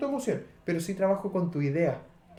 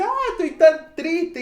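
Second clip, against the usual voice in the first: hammed-up sigh of sadness, much higher, louder and slow; mock sadness; 10 semitones higher, 9 dB louder, slower than usual. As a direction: ham up a theatrical lament, drawn out high and loud. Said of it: "No es como que uno dice".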